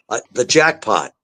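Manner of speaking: ordinary delivery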